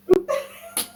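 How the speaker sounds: shrill giggle burst; high squealing laugh. Retold or imitated. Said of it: "she was like".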